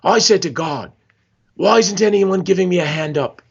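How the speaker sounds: bad Aussie impression drifting toward Scottish; horrible Australian accent. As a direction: put on a hammy, wobbly Australian accent. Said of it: "this is my horrible Australian accent".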